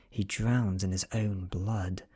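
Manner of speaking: plain reading voice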